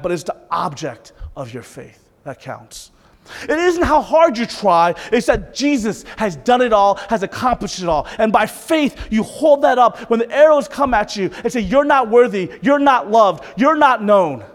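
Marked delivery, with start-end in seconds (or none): 3.4-14.4 loud, high sermon delivery; full-force preaching. Real, not acted. Preacher urging the congregation to hold up faith, full-force preaching. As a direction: drive each phrase home to the congregation.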